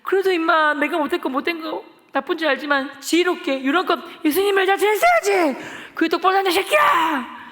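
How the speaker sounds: loud, high, slow reproach building to a yell; furious scolding. Retold or imitated. Boy berating a classmate about church, furious. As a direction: chew the kid out.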